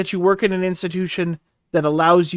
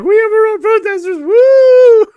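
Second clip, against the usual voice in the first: high, loud gleeful whoop; mock excitement; 11 dB louder, slower than usual. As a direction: cheer like you just won a prize. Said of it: "it'd be like".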